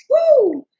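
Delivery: loud high sung-out whoop. Retold or imitated. Celebrating [name]'s songs, loud whoop. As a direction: let out a loud, high woo.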